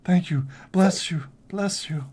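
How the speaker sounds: grateful captive. Acted. Captive blesses a rescuer, lightly acted fervent gratitude. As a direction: pour out thanks, pitch lifted.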